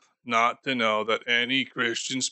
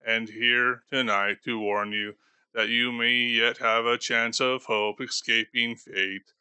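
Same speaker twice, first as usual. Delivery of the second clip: usual speaking voice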